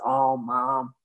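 low-voiced affectionate grown son; low, easy mock-son voice; Sam. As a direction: imitate a son reassuring his mother. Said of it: "Sam laughed and he goes".

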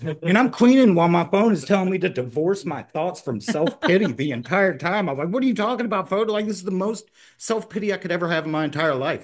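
high-pitched, worked-up outburst at the phone; exasperated rant. Retold or imitated.

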